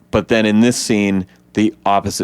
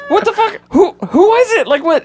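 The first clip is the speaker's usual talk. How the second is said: much higher, louder mock freakout; frantic disbelief; 4 dB louder than usual. Texting friends after the screening, high frantic disbelief. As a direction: squeal it like frantic texts. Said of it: "texting people like".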